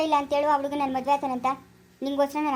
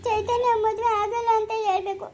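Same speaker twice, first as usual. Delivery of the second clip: weeping daughter pleading; crying; 6 semitones higher than usual. Acted. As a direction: cry while begging. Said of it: "ಅಳ್ಬೇಡ, ನಿಲ್ಸು".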